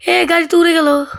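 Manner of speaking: suddenly startled gamer yelp; startled surprise. Real, not acted. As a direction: blurt it out as the car takes off. Car suddenly flies away.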